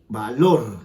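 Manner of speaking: "valor" with the final -lor punched; stress on last syllable. Shown as a demonstration. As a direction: hit the end of "valor": va-LOR. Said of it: "mayor fuerza de voz".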